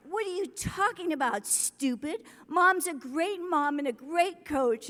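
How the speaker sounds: a hint of a daughter sticking up for mom; mock-indignant child defending her mother; indignant little girl.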